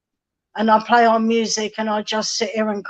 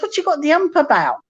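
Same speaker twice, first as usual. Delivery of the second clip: quick, higher mock-gruff voice of Bob; imitating Bob teasing; 6 semitones higher, faster than usual. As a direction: toss it off fast like Bob ribbing her. Bob teasing her, retold.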